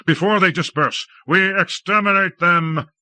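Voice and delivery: high eager urging; high excitement. A narrator's reading. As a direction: push the order out in a rush of glee. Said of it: "in high excitement".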